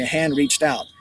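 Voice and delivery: usual speaking voice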